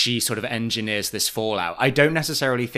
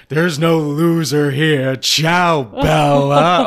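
put-on very slow, louder, grand drunk goodbye; drunk diva farewell; 4 semitones higher, 8 dB louder, much slower than usual. [name] exiting drunk in her feather stole, slow grand mock farewell. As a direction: sweep out like a tipsy diva.

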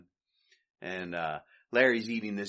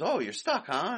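Curly taunt; 7 semitones higher than usual. Retold or imitated.

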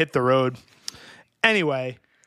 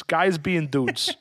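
slow, savored; much slower than usual. Pretend. Savoring the phrase.

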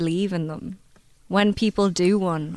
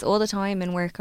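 ordinary talk

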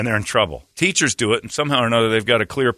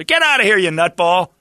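much higher and louder, hammed-up shout; mock angry yell; 7 semitones higher, 5 dB louder than usual. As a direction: run off the creep at the bus stop. Parent chasing off bus stop man.

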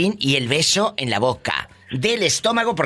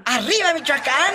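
high, ringing cheer; rousing cheer; 11 semitones higher than usual. Real, not acted. Host hails caller's state, high excited cheer.

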